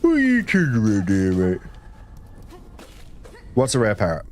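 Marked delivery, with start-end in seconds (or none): 0.0-1.6 gummy, mushy mouth, no teeth; toothless voice. Acted. Streamer asks chat a question, toothless gummy voice. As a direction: talk like you've lost all your teeth. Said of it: "why am I talking like I've got no teeth".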